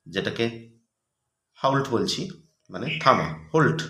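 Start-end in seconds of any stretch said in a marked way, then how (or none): 3.5-3.8 হোল্ট said as holt, with a rounded o; হোল্ট with an o sound, like hold plus t; pronunciation demo. Shown as a demonstration. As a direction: say হোল্ট as "holt", not "halt". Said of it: "আমরা হোল্ট বলি এইভাবে".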